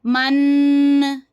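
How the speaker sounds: मन dragged out slowly, the wrong way; teacher demonstrates the mistake to avoid; wrongly stretched. Shown as a demonstration. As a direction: draw out मन too long on purpose. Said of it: "इसको लंबा नहीं करना".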